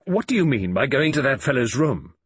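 high, loud, indignant demand; immensely angry. A narrator's reading. Father demanding why his daughter visited that man, angrily. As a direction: confront her in sudden anger. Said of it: "I was suddenly immensely angry".

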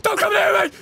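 high, panicked squeal of a victim; hammed-up scared victim voice; mock terrified plea. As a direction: beg him to stay away, way up high. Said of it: "he's just like".

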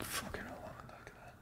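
starstruck whisper, barely audible. Retold or imitated. Whispering to himself on spotting Ant and Dec, awed. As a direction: hush it so nobody near hears.

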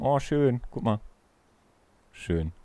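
usual speaking voice

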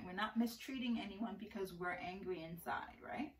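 soft unvoiced whisper. Real, not acted. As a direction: whisper it like a secret to the class. Gentle lesson aside.

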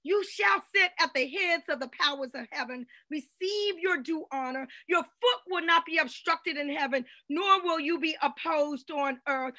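ordinary delivery

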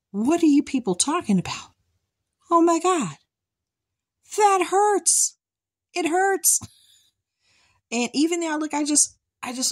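disbelief at the pain, voice climbing; exasperated. Real, not acted.

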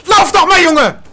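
furious yelling at a teammate; angry shout. Real, not acted. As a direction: yell it in rage. Raging at teammate.

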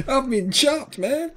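high, soft words through laughter; laughing. Real, not acted. Echoing his partner's words, laughing through them. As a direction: repeat it while still cracking up.

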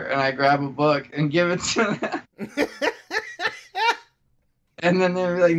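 usual speaking voice

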